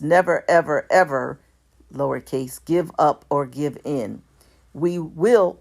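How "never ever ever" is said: never, ever, ever hammered louder, each word punched; all caps emphasis. Retold or imitated. Reading a decree aloud to supporters, never ever ever louder and punched. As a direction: hit every "ever" hard like capital letters. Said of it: "in all caps".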